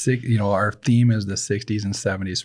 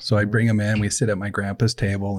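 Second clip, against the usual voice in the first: usual speaking voice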